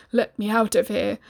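usual speaking voice

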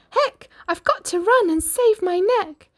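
high, loud, alarmed cry; panicked shout. Acted. Cindy fleeing the palace at midnight, high panicked shout. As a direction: shout it in a rush to flee. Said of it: "She shouted".

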